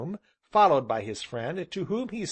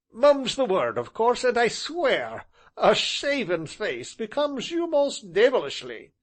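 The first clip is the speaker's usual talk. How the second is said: high, knowing tease; sly teasing; 10 semitones higher, 4 dB louder than usual. Acted. Tall gentleman teasing the traveller slyly. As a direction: tease with a wink.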